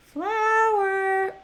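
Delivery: calling a name across the field. Real, not acted. Calling out to character.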